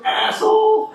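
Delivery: high put-on teen voice; laughing bratty kid voice; mock teen sneer. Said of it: "he goes".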